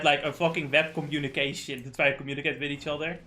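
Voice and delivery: usual speaking voice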